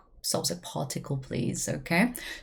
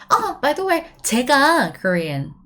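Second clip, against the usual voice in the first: high, louder Oh by the way, then quick 제가; surprised pop-in; 6 semitones higher, 5 dB louder than usual. Shown as a demonstration. Someone is talking about you and you jump in, bright. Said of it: "you can actually pop up".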